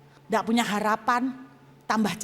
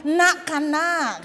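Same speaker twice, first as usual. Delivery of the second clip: loud, high, very drawn-out Madurese call; Madurese accent; 6 semitones higher, 6 dB louder, much slower than usual. Shown as a demonstration. Showing how Madurese call their child, exaggerated Madurese accent. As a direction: stretch it out in a Madurese lilt. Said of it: "Kalau manggil anak, ndak anakku".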